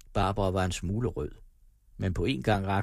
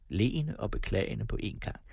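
usual speaking voice

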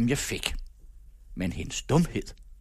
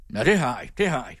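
mild shout; faster than usual. A narrator's reading. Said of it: "råbte han".

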